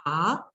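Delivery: AH gliding upward; rising tone. Shown as a demonstration. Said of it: "it's a rising tone".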